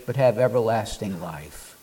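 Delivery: ordinary delivery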